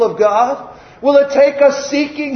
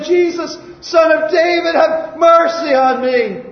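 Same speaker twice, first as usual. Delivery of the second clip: crying out; 5 semitones higher than usual. Acted. Blind beggar begging Jesus for mercy, crying out. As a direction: cry it out high, then stretch the plea. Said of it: "He cried out all the more".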